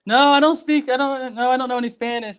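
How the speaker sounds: high, insistent mock protest; flustered retold protest. Retelling how he told the older gentleman no, flustered.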